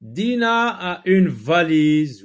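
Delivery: slow, loud, high-pitched sentence reading; model reading aloud. Shown as a demonstration. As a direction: read it out slowly to the class. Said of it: "Relis les phrases".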